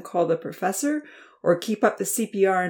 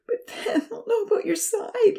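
very high, breaking worried voice; starting to sob. A narrator's reading. Uncle fearing he'll expose the secret, breaking. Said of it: "Louis began to sob".

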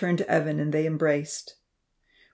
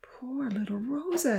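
slow, soft, higher, tearful; crying; 6 semitones higher, 6 dB quieter than usual. A narrator's reading. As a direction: grieve aloud for the little one. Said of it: "he cried".

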